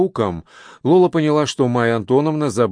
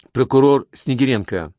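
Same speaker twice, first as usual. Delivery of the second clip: plain reading voice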